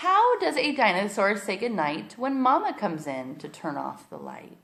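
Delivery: put-on storybook read-aloud for little kids; reading to children. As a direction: read it like bedtime story time. Reads picture book aloud to kids.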